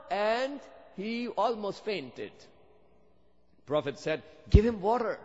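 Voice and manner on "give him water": urgent call. Retold or imitated. Prophet orders water for Abu Dhar.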